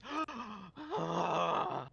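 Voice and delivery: high, drawn-out gasp; big theatrical gasp; hyena man's gasp. Acted. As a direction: gasp loudly like an excited hyena man. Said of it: "the hyena man goes".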